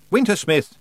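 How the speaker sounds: loud, high call of the name; calling out again. Acted. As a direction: shout for him across the ice. Tiffany calls out again.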